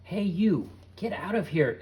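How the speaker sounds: loud high yell out the window. A narrator's reading. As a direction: chase them off with a yell. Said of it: "began yelling".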